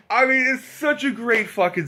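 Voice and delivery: high-pitched, laughing enthusiasm; real laughing delight. Real, not acted. Fan delighted by the diner scene, laughing delight. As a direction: gush through a laugh.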